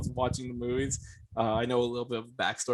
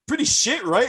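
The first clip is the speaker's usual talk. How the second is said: mock scoff; 12 semitones higher, 5 dB louder than usual. Retold gym member scoffing at movie.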